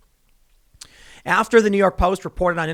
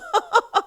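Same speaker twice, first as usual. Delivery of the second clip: very high fake cackle; staccato derisive ha-ha; put-on snobbish laughter; mocking laugh. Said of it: "the media mocked and laughed".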